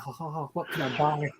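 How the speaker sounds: soft, breathy mock wonder; quiet imitation of dazed delight; hushed awe. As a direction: play overwhelmed by the toys, hushed. Acting out being awed in shop.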